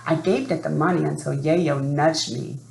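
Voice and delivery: plain reading voice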